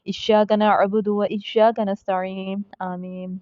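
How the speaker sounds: melodic Quranic recitation. Shown as a demonstration. Teacher opening the lesson, reciting in tajweed melody. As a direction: recite the verse in tajweed style.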